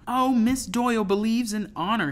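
high, loud sing-song mockery; exaggerated high mock admiration; mocking mimicry. A narrator's reading. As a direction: sneeringly parrot the praise. Fisk mockingly mimics Zachariah's praise.

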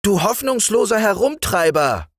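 little frog prince yelling at his friend; shouting frog prince. Acted. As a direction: scold him shrilly as a rascal. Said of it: "schrie er".

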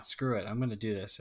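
ordinary talk, nothing marked

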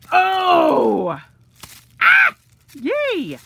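loud, high squeals of joy; excited squeals. Real, not acted. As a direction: shriek with delight at the gift. Unboxing squeals of delight.

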